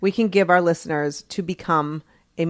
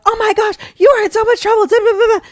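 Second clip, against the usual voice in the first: high, loud scolding mock parent; freaking-out parent; 14 semitones higher, 5 dB louder, faster than usual. Parent scolds a kid over stolen candy in a high, loud mock voice. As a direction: play the panicking parent piling on the blame. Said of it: "and if you're like".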